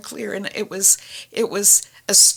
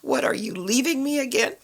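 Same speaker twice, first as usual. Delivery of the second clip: much higher, anxious question; anxious plea; 9 semitones higher than usual. Retold or imitated. Asking God if he's leaving her, much higher and anxious. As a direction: plead in a raised voice. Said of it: "I actually said out loud to him".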